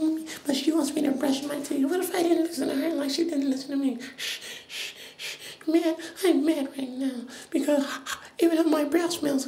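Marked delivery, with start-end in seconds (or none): 4.2-5.4 shushing. Acted. Child refusing to listen to mommy, soft shushing. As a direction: hush softly, several times over.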